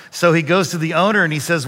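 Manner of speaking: ordinary delivery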